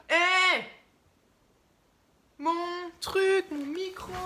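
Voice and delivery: sudden loud cry at someone; yelling to stop them; startled shout. Real, not acted. Someone touching her camera and microphone.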